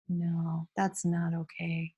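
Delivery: soft teacher's no; quiet disapproval. Pretend. Teacher disapproving to imagined class.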